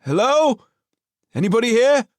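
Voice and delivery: high, loud call into an empty house; loud, searching hello into silence; shouting. Acted. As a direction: call out to see if anyone's home. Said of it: "he shouted".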